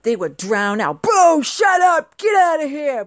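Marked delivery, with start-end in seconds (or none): 1.0-3.0 slow, loud mock booing; booing crowd. Imitating the American crowd drowning out Russians, loud mock booing. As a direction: jeer like a rowdy crowd. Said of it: "by all the Americans around them".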